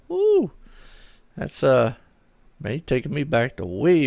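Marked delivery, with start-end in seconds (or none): 0.1-0.5 high excited whoop; sudden high-pitched whoop of surprise; excited woo. Real, not acted. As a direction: let out a high, delighted woo. Reacts to viewer turning sixteen.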